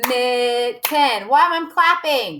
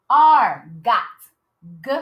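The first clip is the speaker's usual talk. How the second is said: normal talking voice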